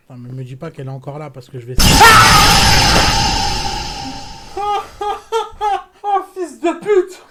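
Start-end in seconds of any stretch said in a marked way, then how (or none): 1.8-6.0 long loud high scream; terrified scream. Real, not acted. Jump scare in the clip. 6.0-7.2 high, loud startled curse; scared yell. Real, not acted. Reacting to jump scare.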